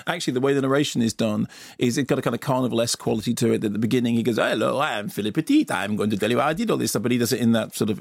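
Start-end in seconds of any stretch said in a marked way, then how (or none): 4.4-7.0 light mock French accent, a touch quicker; mild French accent impression, brisk; imitated French accent. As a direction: do a hint of Joseph Gordon-Levitt's French accent. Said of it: "in the Joseph Gordon-Levitt accent".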